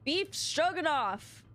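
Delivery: Beef Stroganoff said slowly and grandly; mock proclamation. Shown as a demonstration. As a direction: announce the joke name with a flourish. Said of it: "That was his name".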